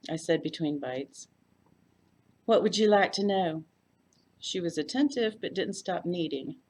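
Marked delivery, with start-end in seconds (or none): 2.4-3.6 Texas hostess. A narrator's reading. Floy's voice.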